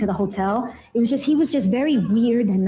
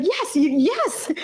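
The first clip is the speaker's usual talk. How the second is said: eager yes; 9 semitones higher, much slower than usual. Retold or imitated. Eager yes to his surgery.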